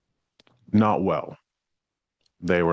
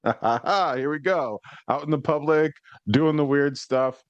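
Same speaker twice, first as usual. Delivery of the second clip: light mock laugh, then a higher, amused aside; amused inner voice; 6 semitones higher than usual. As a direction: laugh it off lightly as you walk on. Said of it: "and I'm like".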